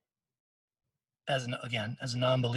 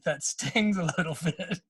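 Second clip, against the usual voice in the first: laughing through it; 4 semitones higher than usual. Retold or imitated. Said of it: "almost fell on the ground laughing".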